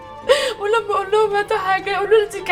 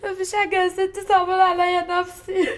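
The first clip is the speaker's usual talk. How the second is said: tearful. Acted. Maid feeling sorry for herself, tearful. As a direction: draw the words out through sobs. Girl crying with self-pity.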